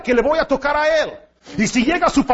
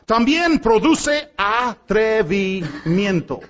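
normal talking voice

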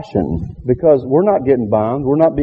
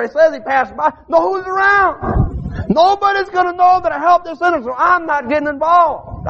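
high, rising revival preaching; preaching at full force; 10 semitones higher than usual. Acted. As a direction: preach it out to the pews. Preacher builds the parable.

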